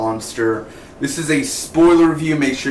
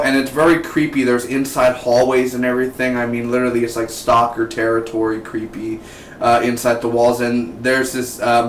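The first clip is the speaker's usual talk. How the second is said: ordinary talk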